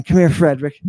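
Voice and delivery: ordinary talk, nothing marked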